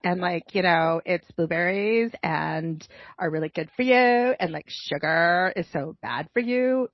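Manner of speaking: exaggerated mock Valley Girl lilt; Valley Girl accent. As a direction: imitate a Valley Girl explaining science. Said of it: "That is a Valley Girl accent".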